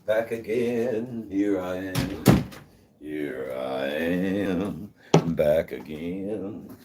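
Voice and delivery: slow, drawn-out mock American accent, half sung; hammed-up American twang, stretched out slowly; terrible American accent. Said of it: "I've got a terrible American accent".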